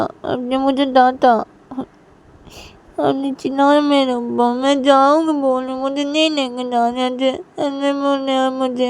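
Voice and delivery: put-on high, loud wailing child; tearful protest. Hurt daughter accuses mother of shouting at her, tearful wail.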